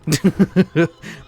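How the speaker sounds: a loud bursting laugh; burst of laughter. Real, not acted. Laughing at the silly dismissive reply. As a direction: laugh hard at your own joke.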